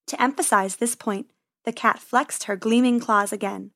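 ordinary delivery, nothing marked

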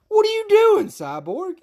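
high, loud what-are-you-doing; exasperated outburst. Real, not acted. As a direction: throw up your hands, loudly. Calling out Cyborg in a rant.

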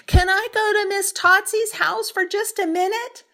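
high, loud call up the stairs; yelled up the stairs. Acted. Matilda yelling up the stairs to her mother. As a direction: shout it up to mother upstairs. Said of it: "She yelled up the stairs".

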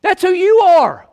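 preacher pressing the point home; fiery preaching. Retold or imitated. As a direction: nail it on the congregation. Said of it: "he looks to them and he says".

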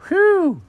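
high, loud exhale of relief; relieved whew. Acted. Cat realizes there is time to shop, high relieved whew. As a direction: let out a high cartoon "whew".

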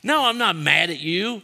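high, loud, heated acted protest; mad-sounding denial. Worked-up speaker answering a friend, mock-angry denial. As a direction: deny being mad while sounding mad. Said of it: "it sounds like they're mad at you".

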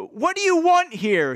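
high-pitched, hammed-up excited demand; excited shout. Third man with club to boys, excited shout. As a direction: call it out with mock agitation. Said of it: "he shouted excitedly".

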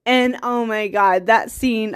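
ordinary talk, nothing marked

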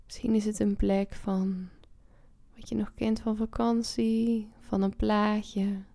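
ordinary talk, nothing marked

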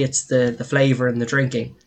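normal talking voice

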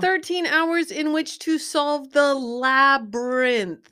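loud, high and slowed right down; dramatic film quote. Acted. Repeating the movie line for a stumped guesser, loudly drawn out. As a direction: proclaim it slowly with theatrical force.

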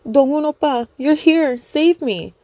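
high, loud desperate plea; high-pitched begging cry; pleading fangirl voice. Acted. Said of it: "started crying and yelling".

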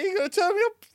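very high, loud Yorkshire wife; Yorkshire accent. Acted. Wife repeats the stranger's threat to her husband, Yorkshire accent. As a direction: squeal the complaint in Yorkshire. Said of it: "the Yorkshire accent really makes it".

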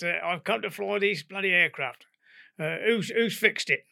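a cocky Cockney pilot wanting his aircraft; brash Cockney demand. Retold or imitated. Pilot demands who fixed the plane, brash Cockney. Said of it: "he was a Cockney".

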